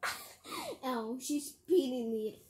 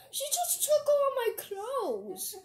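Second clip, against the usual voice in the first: high, slow, drawn-out wail; mock wailing; 11 semitones higher, 3 dB louder, slower than usual. Protesting that the pet took the clothes, mock wail. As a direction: stretch it into a laughing, high-pitched protest.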